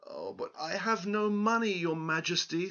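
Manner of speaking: high, pleading farmer voice; pleading old farmer. Acted. Farmer pleads with the king, high and humble. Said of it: "said the farmer".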